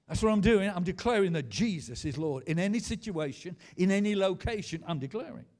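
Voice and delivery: loud, insistent declaration; full-force preaching. Real, not acted. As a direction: hammer it out to the congregation.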